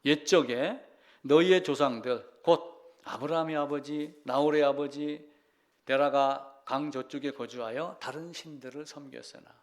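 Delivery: scripture reading. Acted. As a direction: proclaim the verse to the congregation. Reads Joshua 24 aloud.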